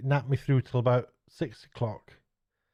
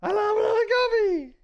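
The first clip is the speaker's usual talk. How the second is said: very high and a bit louder; high falsetto; 4 dB louder than usual. Shown as a demonstration. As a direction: squeal it in falsetto. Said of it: "which was".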